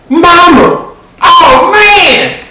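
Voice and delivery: very high, loud yelp of dismay; panicked realization. Acted. Marquette realizing it is his mama's car, high panicked yelp. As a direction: cry out high and loud, horrified.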